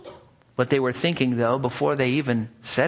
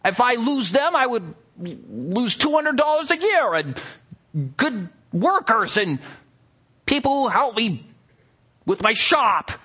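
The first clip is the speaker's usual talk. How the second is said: rough grunting blacksmith; 9 semitones higher than usual. Acted. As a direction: snarl it, greedy and furious. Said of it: "with clenched teeth".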